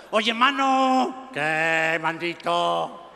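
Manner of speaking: long drawn-out Mexican singsong; slow, hammed-up Mexican lilt; Mexican accent. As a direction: stretch each phrase like a joke Mexican. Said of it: "conversaban dos mexicanos".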